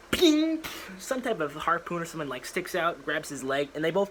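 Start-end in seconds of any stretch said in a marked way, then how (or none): 0.1-0.6 high, loud ping; sound effect. Pretend. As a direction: shout the ping like a cartoon effect.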